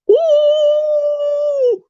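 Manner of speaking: high squealing ooh; spooked squeal. Retold or imitated. Kid imitating spooked Duncan, high squeal. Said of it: "Duncan's from Duncan Gets Spooked when he says".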